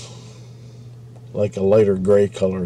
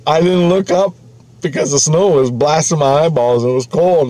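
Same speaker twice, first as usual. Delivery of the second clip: high, loud, breathless retelling of the near miss; imitating a shaken friend, pitched up and loud; excited retelling; 5 semitones higher, 4 dB louder than usual. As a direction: rush it out like you're still shaken. Friend recalls near miss with train.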